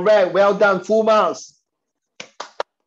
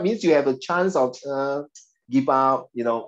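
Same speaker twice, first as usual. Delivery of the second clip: usual speaking voice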